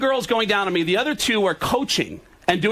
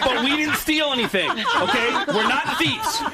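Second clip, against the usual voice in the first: higher, giggling through the quote; laughing mock girl voice; 5 semitones higher than usual. A girl telling the cop, through laughter. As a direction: play the girl while cracking up.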